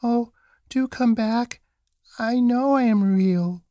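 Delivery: high-pitched calling after someone; little rabbit calling. A narrator's reading. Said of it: "called the little rabbit".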